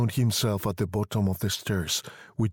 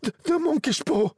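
high, stammered wild outcry; wild cry. A narrator's reading. Wife crying wildly to her sleeping husband. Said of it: "she cried wildly".

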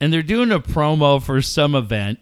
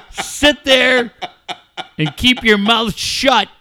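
high, loud wrestler promo voice; Ric Flair; 7 semitones higher, 5 dB louder than usual. Retold or imitated. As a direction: imitate a ranting wrestler, cracking up midway. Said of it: "where he says to a woman".

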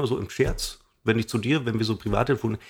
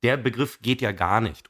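usual speaking voice

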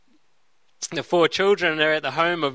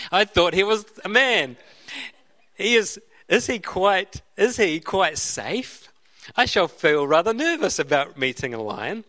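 nervous Susan; 3 semitones higher than usual. Acted. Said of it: "said Susan".

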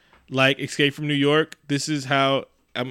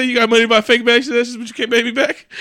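high, loud imitated complaint; high-pitched retold outburst breaking into laughter; mock outrage; 10 semitones higher, 6 dB louder, faster than usual. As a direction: squeal the quote in disbelief. Mock-outraged retelling of scene quote.

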